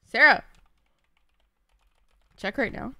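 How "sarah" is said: calling out. Real, not acted. Calling out again.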